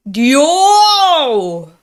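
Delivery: long, loud, high scream; battle cry. Acted. Manga hero calling out his rival, long furious scream. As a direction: scream the name, drawn right out.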